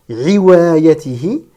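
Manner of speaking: "riwayatihi" opened with a voiced throat sound instead of h; voiced back-of-throat onset on "riwayatihi"; wrong throat consonant. Shown as a demonstration. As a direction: start "riwayatihi" on a gargled r-like consonant. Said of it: "ce n'est pas la lettre ayn".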